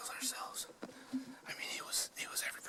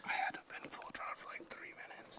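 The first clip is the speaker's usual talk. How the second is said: whispering; 8 dB louder than usual. Real, not acted. Whispering on a hunt.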